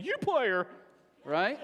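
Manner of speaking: mock-wounded boy snapping back near tears; hurt comeback. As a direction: beaten boy fires back at his teasing friend, choked up. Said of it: "He said".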